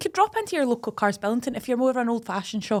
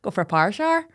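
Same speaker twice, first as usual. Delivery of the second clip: put-on Northern Irish, "power shower" as "par shar"; Irish accent; much faster than usual. Shown as a demonstration. Co-host showing what she always says, Irish accent. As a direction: demonstrate your stock Northern Irish accent phrase. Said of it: "When I'm ever doing an Irish accent".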